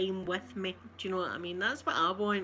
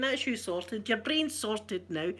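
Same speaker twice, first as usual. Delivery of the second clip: ordinary talk, nothing marked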